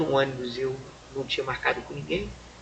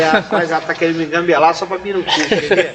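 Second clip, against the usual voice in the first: laughing scoff; 3 semitones higher, 10 dB louder than usual. Retold or imitated. Retelling his own mocking thought.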